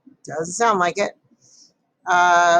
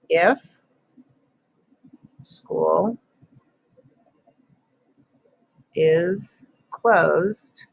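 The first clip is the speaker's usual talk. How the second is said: dictating; much slower than usual. Shown as a demonstration. Writing sentence while saying it.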